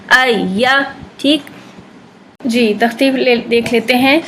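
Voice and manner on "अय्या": अय्या with lengthened doubled ya; correct ya demo. Shown as a demonstration. Teacher demonstrating correct tashdeed ya. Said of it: "आवाज़ आपकी बढ़ जाएगी".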